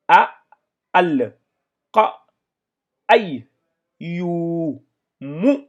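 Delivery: loud, high reading of each syllable. Shown as a demonstration. Teacher reads the verse to the class, loud and clear. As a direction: read the word out syllable by syllable. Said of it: "Ici, nous avons le me là".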